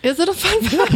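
high, teasing question; mock skeptical. Real, not acted. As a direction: poke fun at the claim. Mocking the fun fact claim.

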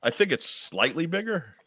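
"slightly" pushed up high, questioning lilt; stress on "slightly". Real, not acted. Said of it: "accent on slightly".

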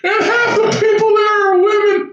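high, laughing through the words. Real, not acted. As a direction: gasp it out between laughs. Still laughing while telling.

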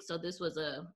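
usual speaking voice

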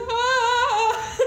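high, strained crying wail; imitating her own sob, half laughing; mock sobbing wail. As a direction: let out a sung, blubbering sob.

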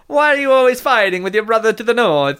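a loud, high, cartoon Italian-American mamma; Italian-American accent. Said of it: "I can do this accent forever".

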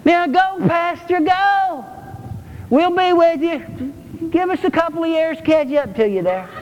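mocking folk who stay behind; mock lazy cheering. Mocking slow congregation urging pastor.

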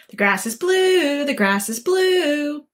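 insistent donkey voice, last word held long; stubborn donkey. Acted. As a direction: repeat it stubbornly, drawing out BLUE. Donkey stubbornly repeats its claim.

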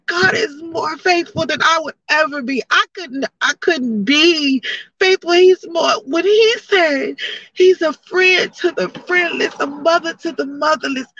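real tears while testifying; crying through praise. Real, not acted. Tearful praise of God.

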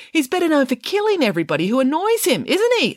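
high-pitched, pointed rebuke; accusing. A narrator's reading. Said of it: "accused Judith Piggins".

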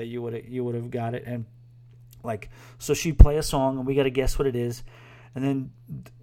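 usual speaking voice

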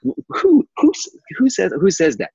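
high, mock-incredulous cry of disbelief. To the camp job offer, high incredulous disbelief. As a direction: act outraged at the lowball pay. Mock outrage at low salary.

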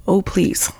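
breathless whispered plea, voice gone; faint frightened whispered please; whimpering plea. Retold or imitated. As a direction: whisper it like a terrified girl begging. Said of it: "she's crying".

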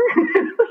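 a burst of high, stunned laughter; high incredulous laugh. Real, not acted. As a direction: laugh it off in disbelief. Laughs at own disbelief.

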